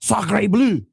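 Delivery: mock French pregame speech. French team's pregame speech, a hammed-up French accent. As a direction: throw it out like a cartoon French coach. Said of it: "That's gonna be the French one".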